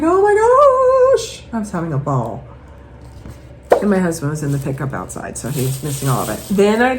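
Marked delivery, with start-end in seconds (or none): 0.0-1.4 high, loud thrilled exclaim; imitated delight; excited gasp. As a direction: burst out at the find. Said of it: "I was like".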